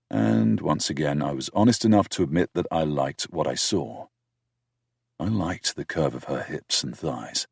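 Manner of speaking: usual speaking voice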